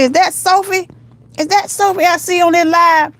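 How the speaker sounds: high-pitched re-enacted amazement; mock-incredulous high voice; acted surprise. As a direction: squeal it as if spotting a friend. Re-enacts spotting a friend's live.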